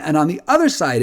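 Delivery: ordinary talk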